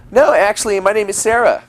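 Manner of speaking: put-on high girly correction; girl voice. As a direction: lift into the girl's voice. Girl corrects him in story.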